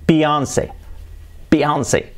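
"Beyoncé" said as bi-ON-sey; English-style bi-ON-say; English pronunciation. Shown as a demonstration. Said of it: "¿cómo se pronuncia?".

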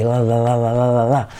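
rapid chant of "la, la, la"; sing-song "la" practice syllables; L drill. Shown as a demonstration. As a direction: repeat "la" evenly, tongue tapping each L. Tongue drill for the L.

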